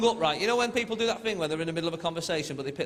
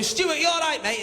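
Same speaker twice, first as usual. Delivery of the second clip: high, louder worried call; acted panic, pitched up; alarmed calling out; 10 semitones higher, 5 dB louder, slower than usual. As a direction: check on a mate who has just collapsed. Calling to hurt friend on floor.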